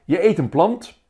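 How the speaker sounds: high, loud delighted call-out; amused call. A narrator's reading. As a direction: shout it out with a laugh in it. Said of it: "Johan lacht en roept". (lachend, luid en hoog geroepen)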